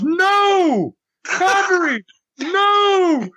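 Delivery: high, cracking mock yell of refusal; heel refusing. Heel yelling back at the referee, high and cracking. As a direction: wail the no like a stubborn heel. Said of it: "He goes".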